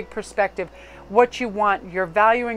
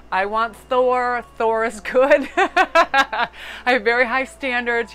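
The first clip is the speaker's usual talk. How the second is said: gushing excitement; 3 semitones higher, 7 dB louder, slower than usual. Real, not acted. Gushing over Thor, laughing.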